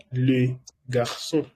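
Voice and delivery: Le garçon slowly, syllable by syllable; slow French demo. Shown as a demonstration. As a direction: model Le garçon slowly for the class. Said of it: "Eso se pronuncia". (Le garçon despacio, como modelo)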